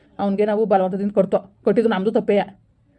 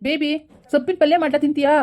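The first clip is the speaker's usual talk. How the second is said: sweet higher coaxing voice; butter her up lovingly; coaxing husband; 6 semitones higher than usual. Acted. Husband sweetly coaxing his pregnant wife with food.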